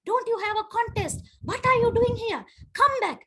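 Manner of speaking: high, loud mock scolding; hammed-up bossy daughter. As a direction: boss mama home like a little schoolmarm. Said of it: "she's like".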